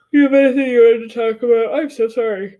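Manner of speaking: asked through a big yawn. Real, not acted. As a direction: stretch the words out in a yawn. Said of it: "That's all yawns".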